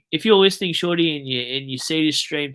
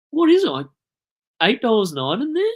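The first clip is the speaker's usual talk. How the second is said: loud, very high-pitched disbelief; squeaky-high astonishment at the price; incredulous; 9 semitones higher, 8 dB louder than usual. Real, not acted. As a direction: question it in high, loud outrage. Working out shocking grocery prices.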